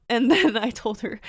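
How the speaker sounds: normal talking voice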